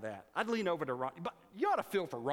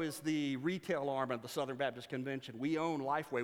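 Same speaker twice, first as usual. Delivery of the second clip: ordinary delivery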